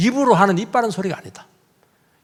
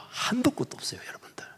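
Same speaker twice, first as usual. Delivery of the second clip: breathy aside to the congregation; whisper; 7 semitones higher, 15 dB quieter than usual. Real, not acted. Pastor confiding to the congregation in a whisper. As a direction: whisper it as a secret. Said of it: "말을 하려면요".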